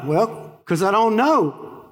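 acted helpless bafflement; clueless thief. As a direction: plead honest ignorance, drawn out. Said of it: "The thief's like".

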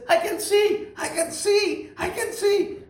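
high, laughing cry of joy; joyful. Retold or imitated. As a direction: burst out with amazed delight. Blind woman suddenly healed.

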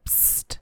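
very soft, voiceless psst; quiet secretive psst; imitated psst. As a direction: whisper a psst like someone unseen wanting attention. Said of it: "if I heard someone go".

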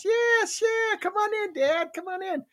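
put-on very high welcoming child voice; little daughter. Little daughter inviting dad to tea party, eager. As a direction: pipe up like an eager little girl. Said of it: "She goes".

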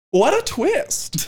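high, slow dramatic line; imitating her. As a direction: savor the twist up high and slow. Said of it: "she goes".

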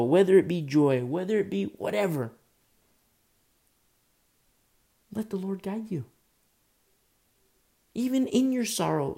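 rising, pleading sermon cadence; fervent preaching. Acted. Preacher urging the congregation to hand feelings to God, fervent. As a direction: press each line home like a preacher.

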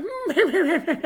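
very high, goofy fake laugh; imitated Lacey Evans laugh. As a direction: mimic a silly high-pitched signature laugh. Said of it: "it just does that".

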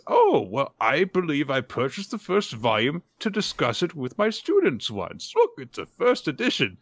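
lightly hammed-up scholarly delight; pleased teacher. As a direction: play a tutor delighted to share a book. Retold teacher delighted by the pupil's books.

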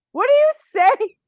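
high, loud, baffled disbelief; baffled what. Retold or imitated. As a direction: throw it back in shocked confusion. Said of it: "And I was just like".

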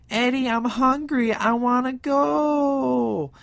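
high, drawn-out whine; annoying whiny voice. Retold or imitated. Angie begging Eddie to leave, slow annoying whine. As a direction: whine it out slowly like a spoiled girlfriend. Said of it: "she's got a real annoying voice".

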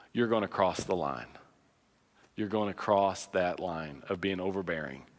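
low, soft pulpit warning; hushed warning. Acted. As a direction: pull back and warn them quietly. Grave warning to parents.

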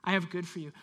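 soft, low, coaxing call; gentle invitation. Acted. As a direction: draw them in tenderly. Voicing God's gentle invitation.

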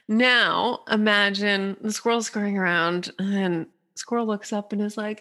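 ordinary delivery

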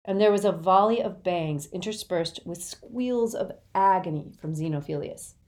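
usual speaking voice

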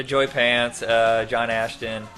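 ordinary talk, nothing marked

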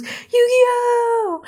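high, stretched-out heroic call; mock battle cry. Yugi calling upon Yami Yugi, mock battle cry. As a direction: call it out like an anime transformation. Yugi's transformation call, imitated.

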